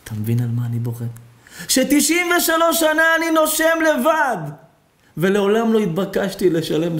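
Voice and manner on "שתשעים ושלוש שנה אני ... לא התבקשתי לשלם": crying shout. Acted. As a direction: wail it out to the heavens. Old man's climax cry.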